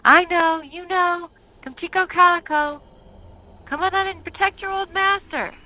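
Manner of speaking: very high, loud call; calling the dogs. Acted. Smitty calling his dogs to protect him, high and loud. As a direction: holler desperately for the dogs. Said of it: "And then he called".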